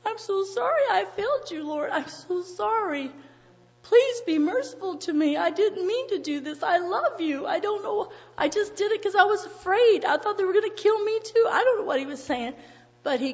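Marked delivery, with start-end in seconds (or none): 0.1-11.5 imitated weeping plea, pitched well up; tearful pleading. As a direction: beg for mercy, acting out Peter's bitter regret. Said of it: "must have been weeping bitterly and saying".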